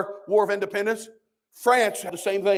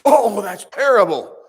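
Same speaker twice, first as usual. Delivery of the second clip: louder, slower sarcastic dismay; mock shock; 2 semitones higher, 7 dB louder, slower than usual. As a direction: play the pearl-clutching critic. Mock imagined objector.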